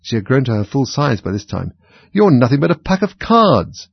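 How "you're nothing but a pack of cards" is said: loud, high, scornful dismissal; emphatic, swooping outburst; defiant. A narrator's reading. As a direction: denounce the lot of them. Alice defies the whole court.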